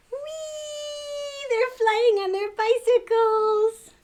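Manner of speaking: high, loud, slow delighted squeal; gleeful whee. Real, not acted. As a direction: soar up with pure delight. Reader cheering the flight.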